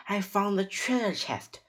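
high-pitched exclamation; excited cry. A narrator's reading. Boy telling his sister of the treasure, excited cry. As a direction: announce the find high and excited. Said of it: "cried Jack".

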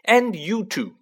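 high, loud order; stern scolding. A narrator's reading. Mother including Ron and George in it, stern. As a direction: turn sharply on the next culprits. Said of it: "she said, glaring at".

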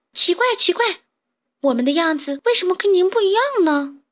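put-on high child-like voice, wondering; high-pitched, baffled little tadpoles; puzzled tadpoles. A narrator's reading. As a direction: ask in puzzlement, pitched right up.

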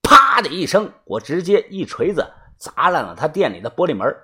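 ordinary delivery

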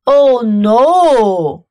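loud, lowing cow voice; mooing cow voice. Acted. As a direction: moo the words like a cow. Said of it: "mooed the cow".

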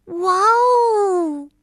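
high, loud cry of wonder; amazed wow. A narrator's reading. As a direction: gasp out at the sight of snow. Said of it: "跳跳惊叹着".

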